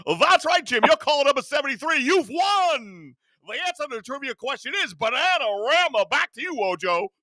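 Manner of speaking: high bright announcer patter; mock game show host. Host plays a radio quiz announcer, high and hammed-up.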